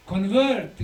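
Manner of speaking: ordinary delivery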